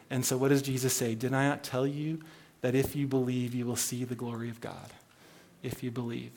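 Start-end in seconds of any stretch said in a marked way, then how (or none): 1.1-4.9 soft rebuke. Acted. Jesus rebuking Martha, soft. 5.6-6.3 hushed emphasis. Real, not acted. Preacher repeats key phrase hushed.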